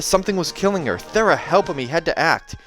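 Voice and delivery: plain reading voice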